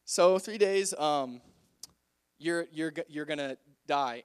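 halting, stammered bad news; awkward. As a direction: break it to them haltingly. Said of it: "how awkward it was".

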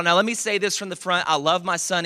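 ordinary talk, nothing marked

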